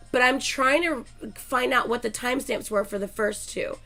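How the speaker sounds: normal talking voice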